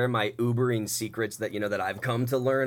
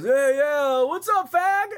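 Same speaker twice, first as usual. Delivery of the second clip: much higher, louder mock drunk bro voice; drunk frat boy; 13 semitones higher, 5 dB louder, much slower than usual. Drunk passenger greeting the Uber driver, brash mock bro voice. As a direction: ham up the rude drunk frat guy. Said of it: "the guy gets into the car and just, goes".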